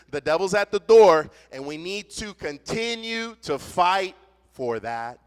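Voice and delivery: fiery pulpit delivery; impassioned preaching. Real, not acted. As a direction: preach it hard to the congregation. Preaching on unity, devil at door.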